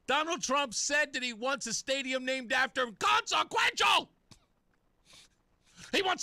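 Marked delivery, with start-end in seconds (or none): 0.1-4.1 mock outrage shout. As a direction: yell it like a scandal.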